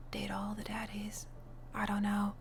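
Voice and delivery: very soft, whispered aside; late-night hush. Real, not acted. Player musing on dating all the daddies, whispered. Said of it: "now a late night talk show".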